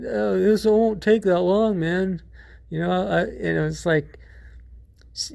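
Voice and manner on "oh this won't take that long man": much higher, louder carefree dude voice; breezy dude. Retold or imitated. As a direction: toss it off like it's nothing, pitched way up. Dude reassures, teller laughing.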